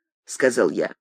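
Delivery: usual speaking voice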